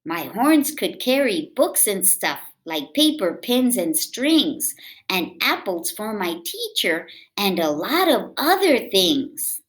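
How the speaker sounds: put-on lively read-aloud for kids; storybook reading. A narrator's reading. Reading a picture book aloud to children, lively.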